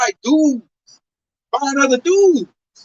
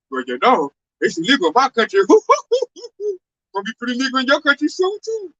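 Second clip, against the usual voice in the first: imitating the lady.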